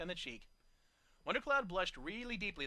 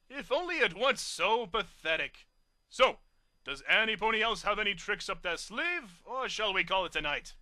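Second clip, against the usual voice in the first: snobbish; 2 semitones higher, 6 dB louder than usual. A narrator's reading. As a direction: sneer grandly. Snob mocks.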